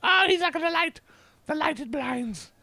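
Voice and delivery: talking to his cat in a high cooing voice. Real, not acted.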